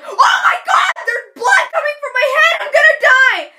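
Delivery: hammed-up panicked scream; freaking out. Hurt girl seeing blood, melodramatic screaming panic. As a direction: shriek high and very loud, mock hysteria. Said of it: "I was there freaking out".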